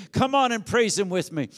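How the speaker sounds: normal talking voice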